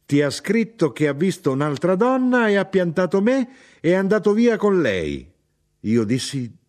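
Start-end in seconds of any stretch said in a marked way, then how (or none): 0.0-5.3 high, loud outburst of outrage; an aggrieved wife crying out; indignant cry. A narrator's reading. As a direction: throw the accusation back in a raised voice. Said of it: "gridò mia madre".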